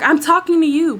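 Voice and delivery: high, insistent mock yell; high-pitched retold yell; imitated screaming. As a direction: mimic a demanding passenger. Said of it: "she's already screaming".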